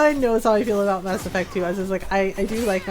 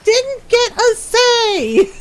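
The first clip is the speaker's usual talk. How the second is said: high, loud, slow mock protest; hammed-up indignant comeback; exasperated retort; 11 semitones higher, 5 dB louder, much slower than usual. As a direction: stretch out each word in exasperation. Acted exasperated retort to accusers.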